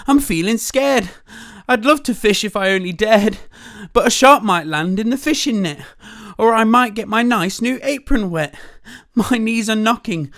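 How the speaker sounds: high, loud trembling fright; scared cook. Acted. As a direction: wail it like a wimpy cook. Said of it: "the cook declared".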